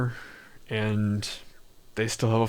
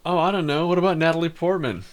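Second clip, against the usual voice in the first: higher, louder mock suggestion; sarcastic; 6 semitones higher, 4 dB louder, faster than usual. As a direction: toss it out sarcastically. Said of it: "That was, that was sarcasm".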